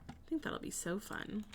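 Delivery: breathy whispered aside. Real, not acted. Streamer whispering to herself about the build. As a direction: whisper it to yourself.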